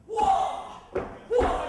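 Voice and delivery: very high, loud whoa; father yelling whoa. Retold or imitated. Father tries to stop a rolling car, yelling. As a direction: yell as if stopping a horse.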